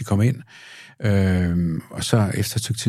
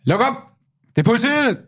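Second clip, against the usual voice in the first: high, raised police announcement; police call-out on request. Shown as a demonstration. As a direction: call it through the door as police. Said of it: "Prøv at gøre det for mig".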